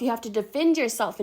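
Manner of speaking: ordinary talk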